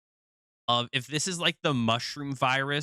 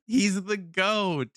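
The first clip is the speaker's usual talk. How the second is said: excited; 6 semitones higher, 5 dB louder, slower than usual. Real, not acted. Excited reaction.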